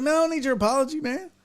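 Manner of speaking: high-pitched, irritated brush-off; retold indignant refusal; exasperated dismissal. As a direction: wave away his apology, fed up. Retells waving off the apology.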